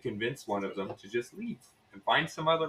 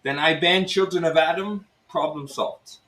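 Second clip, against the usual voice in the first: higher-pitched Russian innkeeper's pitch; Russian accent; 6 semitones higher than usual. Acted. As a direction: sell the scheme in a lively Russian accent. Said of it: "it's Russian".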